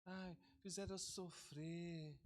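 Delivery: slow, soft whimpering complaint; whiny victim. Retold or imitated. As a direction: drag it out like a hurt victim. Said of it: "A pessoa começa a falar".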